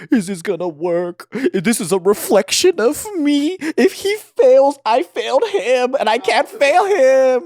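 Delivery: anxious inner voice. Retold or imitated. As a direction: panic rising about failing him. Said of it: "he's like".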